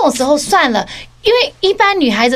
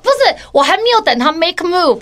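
ordinary talk

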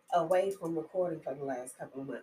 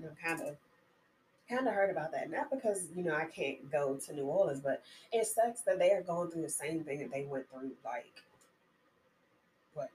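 usual speaking voice

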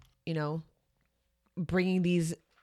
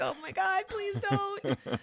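pleading; 12 semitones higher, 4 dB quieter, slower than usual. Retold or imitated. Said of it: "I'm like".

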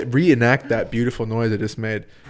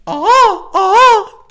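high, loud buzzed bird-call whoop; 10 dB louder than usual. Shown as a demonstration. Showing friends his bar bird call, a loud whoop. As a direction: whoop it from the throat. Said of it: "That's the bird call".

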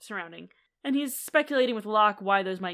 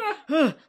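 exaggerated heavy sigh; 6 semitones higher, 7 dB louder than usual. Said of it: "always out of breath".

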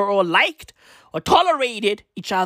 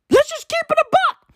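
high squeaky aside; mock falsetto; 4 dB louder than usual. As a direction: toss it off in a silly high voice. High put-on voice.

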